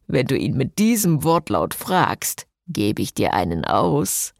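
lightly voiced man, low and amused; a hint of a low male voice, smug; grinning. A narrator's reading. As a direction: say it with a grin. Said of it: "grinste Jason".

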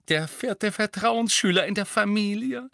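high, proud, loving; proud mum showing off her son; fond pride. A narrator's reading. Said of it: "sagte sie liebevoll".

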